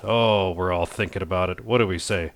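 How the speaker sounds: ordinary talk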